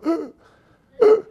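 high strained gasps for air; breathless gasping grunt. Retold or imitated. Overstuffed diner gasping at the table. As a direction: gasp like your lungs shrank. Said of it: "You're going".

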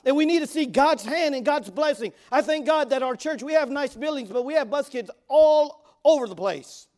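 loud, high fervent preaching. Real, not acted. Pastor preaching loud to the congregation. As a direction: preach it out to the church.

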